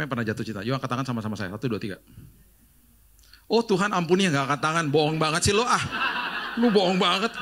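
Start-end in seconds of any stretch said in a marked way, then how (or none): 3.5-4.8 loud, teasing mock plea; mock prayer. Preacher teasing the congregation, loud mock prayer. 4.9-7.3 loud, laughing accusation; laughing tease. Real, not acted. Preacher teasing the crowd, loud and laughing.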